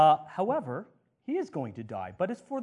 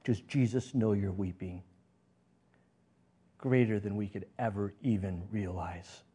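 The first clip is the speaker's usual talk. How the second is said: ordinary talk, nothing marked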